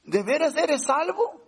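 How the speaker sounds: high, pointed challenge in a borrowed voice; a lightly voiced James, questioning sharply; voicing the apostle James. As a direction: challenge them as James would. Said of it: "por eso Santiago dice".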